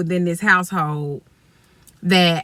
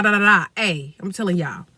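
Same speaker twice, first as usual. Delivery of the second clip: usual speaking voice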